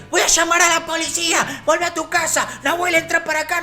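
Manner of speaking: high-pitched mother yelling threats; mock maternal shouting; imitated irate mother. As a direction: scold high, as an alarmed mum. Imitates mother intervening, threatening police.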